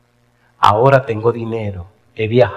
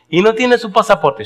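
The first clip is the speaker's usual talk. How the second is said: higher, louder questioning lady; curious lady; 10 semitones higher, 4 dB louder than usual. Retold or imitated. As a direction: press him like a nosy lady, pitched up. (señora preguntona, voz más aguda)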